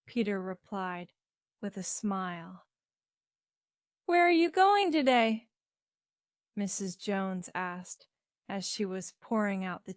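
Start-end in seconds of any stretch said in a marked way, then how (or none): 4.1-5.5 high, louder, inquiring voice; motherly question. A narrator's reading. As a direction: ask it brightly pitched up, as a mother. Mother questions son at breakfast.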